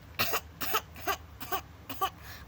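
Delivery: put-on high breathy baby whimper; small breathy pre-cry coughs; unvoiced infant sniffles; baby coughs first. Shown as a demonstration. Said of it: "You gotta, like".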